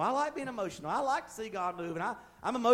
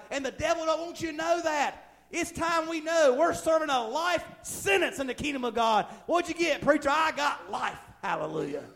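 high, driving preacher delivery building to a peak; fervent preaching; 6 semitones higher, 4 dB louder than usual. Acted. Preacher telling the church they have already overcome, fervent preaching. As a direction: build the sermon to a ringing climax.

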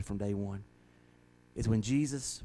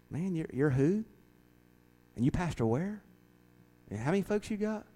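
skeptical high-pitched big-shot preacher; dismissive big preacher; 6 semitones higher than usual. Retold or imitated. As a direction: brush off the nobody pastor, higher. Imagined preacher brushing him off.

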